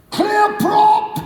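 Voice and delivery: high, loud cockpit shout; pilot's high shouted call; shouted warning. Retold or imitated. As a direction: call it out the door to nobody. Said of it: "opens the door and goes".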